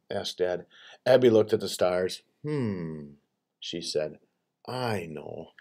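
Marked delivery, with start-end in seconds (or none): 2.4-5.5 low, soft musing; quiet pondering. A narrator's reading. Child looks at the stars choosing a story, quiet musing. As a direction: think it over softly, pitched down.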